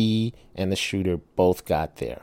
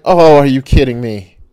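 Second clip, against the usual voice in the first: exasperated outburst; 5 semitones higher, 12 dB louder than usual. Retold or imitated. Daughter drives past him.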